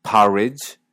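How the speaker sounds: "porridge" as pahridge; open vowel. Shown as a demonstration. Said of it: "El primer sonido aparece".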